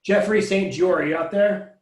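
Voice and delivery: normal talking voice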